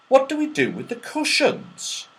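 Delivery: Franny wondering. Acted. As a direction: ask it wide-eyed. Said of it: "said Franny".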